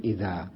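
إذا with no n, vowel stretched; lengthened ending. Shown as a demonstration. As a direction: draw out the aa of إذا. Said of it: "بل نمدها نقول".

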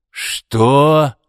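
loud, outraged roar. A narrator's reading. Arkady hears about his son, roaring. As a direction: roar the question out, pitch jumping up. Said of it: "взревел Аркадий".

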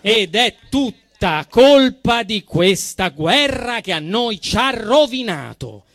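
ordinary talk

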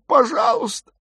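put-on very high, desperate girl's cry; high-pitched begging; gasped plea. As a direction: plead in a high frightened voice. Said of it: "выдохнула Дагмар".

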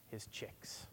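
softly whispered. Acted. Pastor whispering tenderly about the Holy Spirit. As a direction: breathe it out gently. Sermon drops to a hush.

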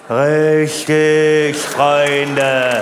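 stretched-out, level put-on voice; drawn-out catchphrase. Comedian confirming the name to his audience, drawn-out catchphrase. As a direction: draw each word out long on one note.

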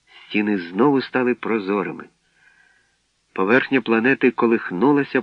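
usual speaking voice

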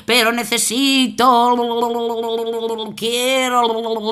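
slow, odd river voice; river making a cheeky wish. Acted. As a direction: draw out the demand lazily. River makes odd demand.

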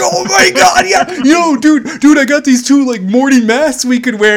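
loud, high, hammed-up enthusiasm; mock an excited drunk pitching a brilliant idea. Acting out excited drunk brothers.

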